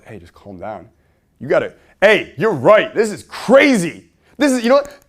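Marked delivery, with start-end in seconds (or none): 0.0-0.9 much softer voice, trying to settle someone; calming down. Shown as a demonstration. Wrong response to someone worked up, soft and calming. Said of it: "don't go". 2.0-5.1 high, loud outraged agreement; matching their anger. Shown as a demonstration. Coach showing how to meet someone worked up, outraged shouting. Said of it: "you need to meet them where they're at".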